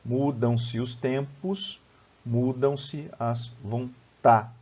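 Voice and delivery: very slow, each syllable set apart; syllable-by-syllable scansion. Shown as a demonstration. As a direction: count out the verse syllable by syllable. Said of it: "vou distribuir aqui".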